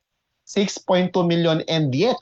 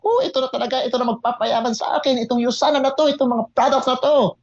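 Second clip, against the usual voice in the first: mock excited shout; 8 semitones higher than usual. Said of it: "nagsisisigaw ako".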